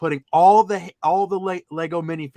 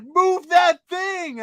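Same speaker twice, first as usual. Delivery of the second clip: very high, loud, drawn-out demand; imitated yelled order; 14 semitones higher, 5 dB louder, much slower than usual. Storyteller imitates the lady grabbing the frame, yelled order. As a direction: holler it in a mock high voice.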